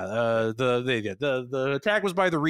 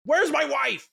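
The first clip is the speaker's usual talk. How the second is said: frantic shout. Retold or imitated. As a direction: shout it, desperate to see her. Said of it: "Sheridan's like".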